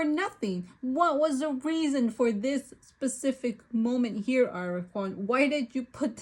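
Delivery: venting at the author, voice rising; frustrated rant. Real, not acted. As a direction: demand answers from the author. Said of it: "calm down".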